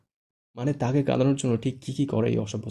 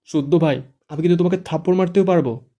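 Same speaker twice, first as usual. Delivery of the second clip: high, loud threatening yell; shouting; 6 semitones higher, 7 dB louder than usual. A narrator's reading. Fara shoving Shuddho away, shouting a threat. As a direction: yell a warning while pushing him off. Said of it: "ফারা চেঁচিয়ে ... বলে".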